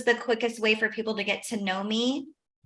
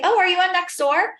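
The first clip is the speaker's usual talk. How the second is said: bright cheerful question; 8 semitones higher, 5 dB louder than usual. Retold or imitated. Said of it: "I say".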